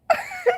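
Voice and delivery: real high burst of laughter. Real, not acted. To the congregation about her story, a high real laugh.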